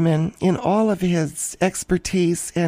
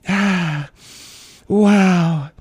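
loud sighing exhale into a breathy wow; deep breathing demo; 4 dB louder, much slower than usual. Shown as a demonstration. Host demonstrates deep breathing to panic-prone listeners with a loud sigh. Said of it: "You just do this".

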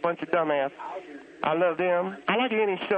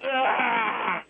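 exasperated shout; 5 semitones higher than usual. Real, not acted. Annoyed outburst at kid.

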